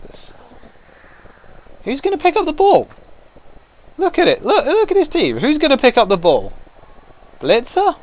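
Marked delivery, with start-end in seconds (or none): 1.8-8.0 much higher sing-song taunt; squeaky mock question. Real, not acted. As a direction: tease them in a high silly voice. Mocking opponent's team.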